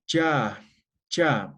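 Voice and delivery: "cha" with the tongue behind upper teeth, firm; palatal cha. Shown as a demonstration. Teacher demonstrating palatal sounds for students. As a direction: say "cha" twice, crisp. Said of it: "Los palatales".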